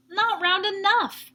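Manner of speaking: fussy little cat rejecting a pumpkin; picky complaint. Acted. Splat telling Seymour the pumpkin fails, picky. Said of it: "he told Seymour".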